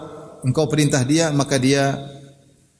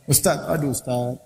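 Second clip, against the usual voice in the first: softer, weary mock plea; complaining; 5 dB quieter than usual. Friend coming to the ustaz with complaints, subdued lament. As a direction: appeal quietly like a troubled man. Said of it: "tempat banyak keluhan".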